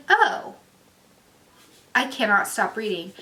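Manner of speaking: thrilled, can't put it down; excited. Retold or imitated. Hooked by the book's twists.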